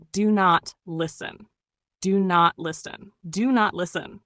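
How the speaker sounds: normal talking voice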